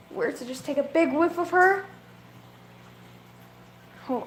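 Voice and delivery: ordinary talk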